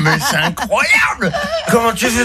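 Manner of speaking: high, laughing cry of disbelief; stunned friend yelling through laughter; amazed laughing shout. Retold or imitated.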